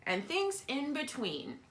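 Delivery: usual speaking voice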